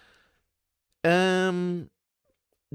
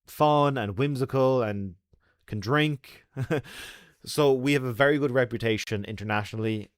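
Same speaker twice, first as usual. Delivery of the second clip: usual speaking voice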